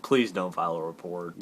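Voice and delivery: low, soft deadpan aside; muted plea. Pretend. Toy character begs the other not to report, low muted plea. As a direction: say it low and under-voiced.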